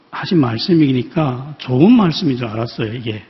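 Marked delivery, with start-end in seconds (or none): none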